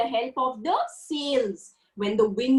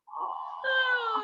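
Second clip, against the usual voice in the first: yawn; 10 semitones higher, 3 dB quieter than usual. Shown as a demonstration. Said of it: "Come on, show me".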